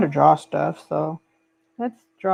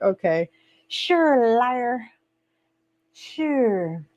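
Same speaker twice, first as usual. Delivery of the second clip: slow, drawn-out sarcastic okay sure; sarcastic disbelief; 4 semitones higher, much slower than usual. Retold or imitated. Answering her husband who never comes on live, sarcastic. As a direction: roll your eyes at an empty promise. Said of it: "I'm like".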